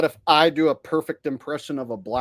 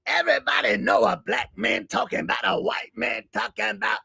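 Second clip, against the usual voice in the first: Chris Rock; 4 semitones higher than usual. Acted. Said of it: "Haven't done Chris Rock in a while".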